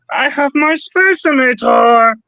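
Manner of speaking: high, slow cartoon alien voice; squeaky little Martian, drawn out; Marvin the Martian voice. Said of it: "pretty good of a Marvin the Martian voice".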